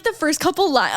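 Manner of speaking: usual speaking voice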